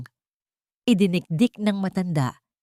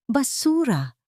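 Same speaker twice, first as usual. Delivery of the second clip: high, scornful outburst; contemptuous shout; 7 semitones higher, slower than usual. A narrator's reading. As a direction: spit out the insult. Husband's contemptuous insult, trailer hook. (mapang-alipustang sigaw)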